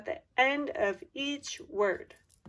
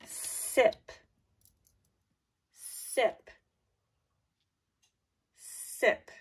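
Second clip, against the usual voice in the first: whispered dictation; much slower than usual. Shown as a demonstration. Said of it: "number four".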